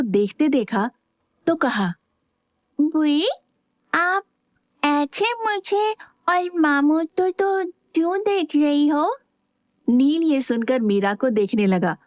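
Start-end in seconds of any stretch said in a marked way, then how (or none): 2.8-9.2 put-on higher, slow little boy voice; curious child. A narrator's reading. Little boy innocently asking his aunt why she stares, childlike. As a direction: ask innocently like a small boy.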